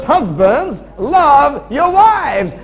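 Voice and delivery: loud, high, drawn-out preaching; shouted command. Real, not acted. Preacher driving the unchanged verse at husbands, shouted command. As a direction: belt out each word slowly.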